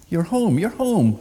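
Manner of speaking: very high, loud, prying bird; shrill crow question, high up; old crow voice. A narrator's reading.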